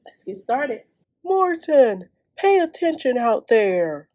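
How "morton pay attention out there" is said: loud call across the field. Acted. Coach calls to Morton in the outfield, loud. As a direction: call the daydreaming kid back.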